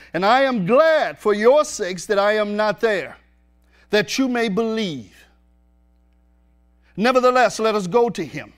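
preacher reading scripture at full voice; preaching scripture. Acted. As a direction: proclaim the verses to the congregation. Preacher reads scripture aloud.

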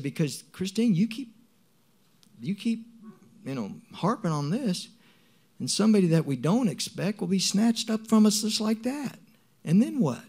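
very high, impassioned argument; urgent warning, retold. Warning Christine about harping on this, urgent.

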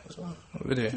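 very soft, hushed mock question; secretive little aside; imitating eavesdropping child. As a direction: ask it under your breath, as a listening kid. Child overhearing serious talk nearby.

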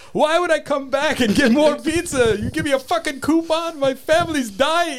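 high, loud mock Arabic accent, slowing at the end; imitated Arabic accent. Mock Arabic accent, outraged caller in a retold prank. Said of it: "Arabic accent".

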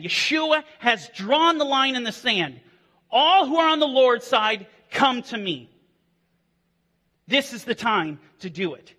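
rally them to take a stand; impassioned altar call. Acted. Pastor urging congregation to repent today, impassioned preaching.

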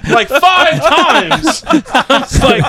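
talking through real laughter; laughing. Real, not acted. Telling the taco story, laughing at the memory. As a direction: laugh while you finish.

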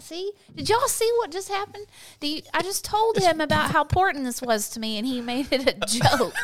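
high, loud playful indignation; mock outrage. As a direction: act offended, laughing.

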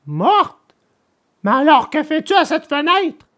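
high, incredulous puppet; baffled disbelief. A narrator's reading. Puppet asking the dead girl why she's at the window, incredulous. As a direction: question her in high-pitched bewilderment.

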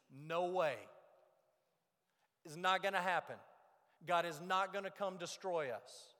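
loud, forceful denial; flatly refuse, raising your voice; emphatic refusal. Acted. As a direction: strongest possible no, punched out loudly. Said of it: "the strongest negation that can possibly be uttered".

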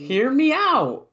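loud, slow, drawn-out plea; tipsy bar argument. Real, not acted. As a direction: plead to be heard, dragging each word.

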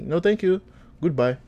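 put-on high-pitched African-accented refusal; polite brush-off in an African accent. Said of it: "throw the accent on".

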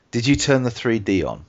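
ordinary talk, nothing marked

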